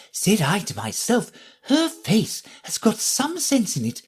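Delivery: high, fussy flower voice; lofty little lady flower, talking down; prim Rose. Acted.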